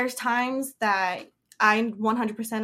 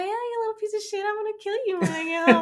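high, fast, cheerful sweet-talk; high happy voice; 11 semitones higher, faster than usual. Retold or imitated. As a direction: coo the threat as if praising. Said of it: "your voice is high, it's happy".

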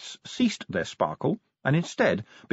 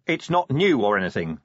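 usual speaking voice

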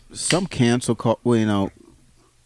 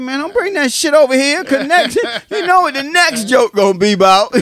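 much higher, louder, fed-up voice; high exasperated retort; 8 dB louder than usual. Retold or imitated. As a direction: shoo the joker away, loud and high. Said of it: "I said".

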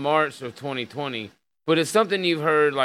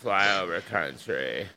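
low, slow put-on country accent; mock rural accent; 7 semitones lower, 3 dB quieter, much slower than usual. Host playing along with co-host's joke, mock country accent.

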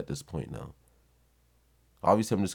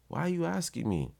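high-pitched exasperated question; acted exasperation; 11 semitones higher than usual. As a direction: throw the question up high, at wit's end. Acted exasperated reply to girlfriend.

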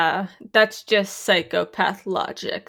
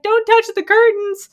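high, louder panicked yell about the curtains; mock panicked shout; 13 semitones higher, 5 dB louder than usual. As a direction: squeal it like a fussy homeowner. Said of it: "Tucker's like".

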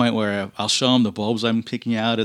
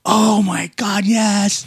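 put-on thrilled girl; 9 semitones higher, much slower than usual. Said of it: "She's like".

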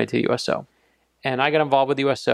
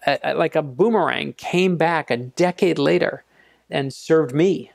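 slow emphatic; 2 semitones higher than usual. Real, not acted. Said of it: "slow emphatic reflection".

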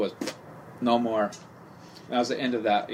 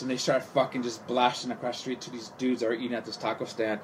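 ordinary talk

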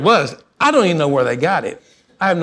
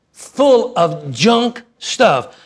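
slow deadpan verdict; 3 semitones higher, much slower than usual. Retold or imitated. Voicing the computer guy.

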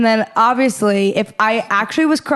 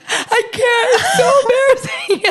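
much higher, laughing through tears; laugh-crying; 13 semitones higher than usual. Retold or imitated. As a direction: sob and laugh at once. Retelling crying embarrassed.

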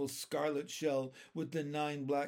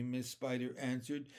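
usual speaking voice

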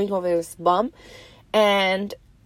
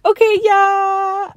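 whiny okay yeah; 11 semitones higher, 6 dB louder, slower than usual. Retold or imitated. Giving in, whining.

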